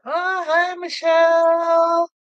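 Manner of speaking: high, long-drawn singsong hello; sing-song greeting. Real, not acted. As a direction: greet a friend in a high, stretched-out lilt. Greeting a viewer who joins.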